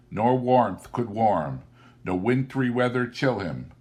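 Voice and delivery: ordinary delivery, nothing marked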